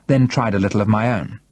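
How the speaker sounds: ordinary delivery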